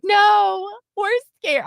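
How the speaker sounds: high-pitched mock whine; imitating scared girls. Girls correcting mom, mock scared whine. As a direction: insist like an overdramatic kid.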